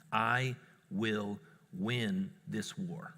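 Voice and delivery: very slow, low and soft; measured, word-by-word quiet resolve; solemn vow. Retold or imitated. Dad vowing to child he will win, solemn.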